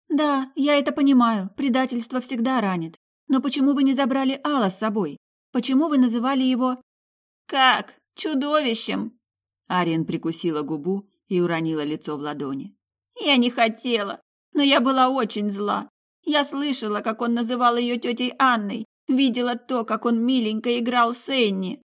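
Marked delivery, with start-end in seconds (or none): 0.1-9.2 higher, eager questioning tone; a lightly voiced curious healer coaxing more; soul-healer's voice. A narrator's reading. 13.2-21.8 higher mother's voice, bitter outpouring; a lightly voiced hurt mother pouring it out; mother's long-held resentment bursting out; pent-up grievance. A narrator's reading. Mother confesses jealousy.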